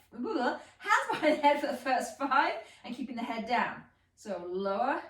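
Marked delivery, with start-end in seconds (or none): 0.1-2.5 laughing through a correction. Real, not acted. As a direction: giggle mid-sentence at own slip.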